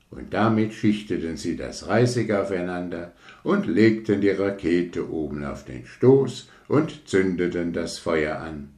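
ordinary delivery, nothing marked

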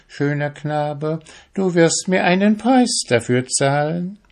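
singsong tempting witch with rising endings; enticing witch. Acted. Witch luring a young fisherman with her powers, enticing.

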